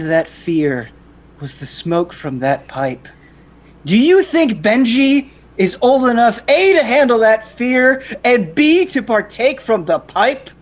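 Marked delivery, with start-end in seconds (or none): 3.8-10.5 high-pitched, emphatic reprimand; acted exasperated challenge; indignant lecture. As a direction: press each point like a scandalized guardian. Older sibling lectures Harriet indignantly.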